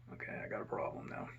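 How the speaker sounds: whispered worried thought to himself; muttered to self. Retold or imitated. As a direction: breathe it out under your breath, worried. Inner thought in tense moment.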